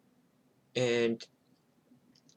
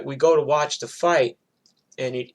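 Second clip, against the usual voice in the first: normal talking voice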